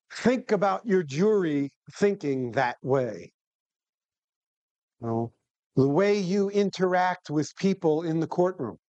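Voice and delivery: ordinary talk, nothing marked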